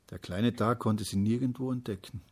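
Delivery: ordinary delivery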